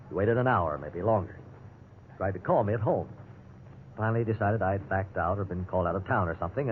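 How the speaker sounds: low, reflective storyteller voice; radio drama narrator recounting his plight; story narration. A narrator's reading. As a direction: narrate it looking back on the night. First-person radio narration.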